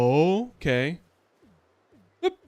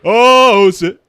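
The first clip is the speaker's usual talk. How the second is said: a sudden loud, higher "Oh" into the mic; mock mic boom; 3 semitones higher, 14 dB louder, much slower than usual. Retelling Disappearing Guy at the stadium mic, loud and sudden. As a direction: blurt it big into the mic, then cut off. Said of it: "comes up to the mic and goes".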